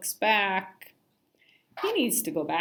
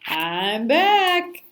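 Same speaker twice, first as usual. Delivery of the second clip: playground yell; 7 dB louder, slower than usual. A narrator's reading. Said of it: "someone yelled".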